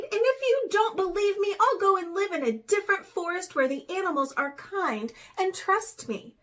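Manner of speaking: hurt and angry. A narrator's reading. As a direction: Raven threatening to leave the forest. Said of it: "she grew angry".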